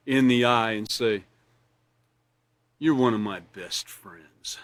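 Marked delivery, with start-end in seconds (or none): 2.8-4.6 low, slow, soft and unconvincing; flat insincere. Shown as a demonstration. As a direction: say it like you don't mean it. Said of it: "if I said it to you like that".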